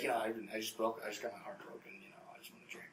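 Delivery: very soft, fading mumble; mock heartbroken plea. Heartbroken guest asking to drink, mumbled softly. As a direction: imitate a sorry murmur trailing into a whisper.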